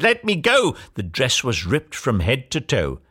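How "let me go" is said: high-pitched cry to be released; urgent, high storybook shout. A narrator's reading. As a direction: shout as if pulling free. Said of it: "As Cindy shouted".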